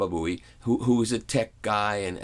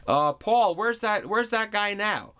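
higher, hammed-up talk-show host quip; a Letterman tease, pitched up; mock Letterman; 12 semitones higher, slower than usual. Letterman asks Paul where the test CD guy is, in a mock host voice.

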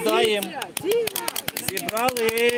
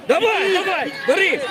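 yelling from the sidelines; 8 semitones higher, 5 dB louder than usual. Real, not acted. Coaches yelling during match.

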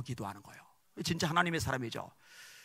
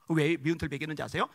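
usual speaking voice

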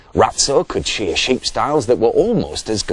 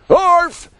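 small agreeing yap; 7 dB louder than usual. A narrator's reading. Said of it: "agreed Tiny".

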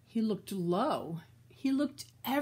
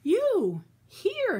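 high, breathless surprise; gasped; 10 semitones higher, 6 dB louder than usual. Acted. Mister Bird gasps, finding his wife at home. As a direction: gasp it out in disbelief. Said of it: "he gasped".

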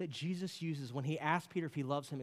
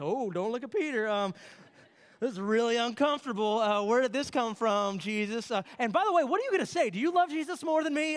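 higher, louder, flustered bystander voice; awkward onlookers; 7 semitones higher, 8 dB louder than usual. Retold or imitated. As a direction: squirm as the embarrassed friends around the fire. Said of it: "everybody's like".

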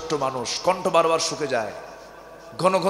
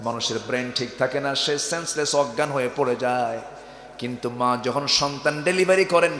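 ordinary talk

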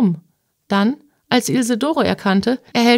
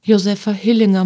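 plain reading voice

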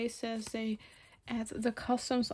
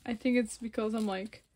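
ordinary delivery

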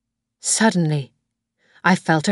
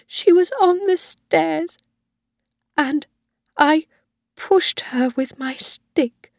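put-on much higher and louder, frantic old mother's confession; a frail old woman blurting out her crime; old Mrs Harvey confessing; 11 semitones higher, 5 dB louder than usual. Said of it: "Missus Harvey began to cry".

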